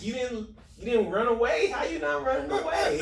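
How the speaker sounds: put-on high-pitched incredulous kids' questions; mock disbelief. Kids reacting to his churchgoing story, incredulous. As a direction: imitate astonished kids, voice climbing with laughter.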